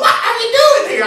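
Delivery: high, loud rooster screech as words. Retold or imitated. Singer voices the roosters, high screechy squawk. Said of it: "That's what it sounded like".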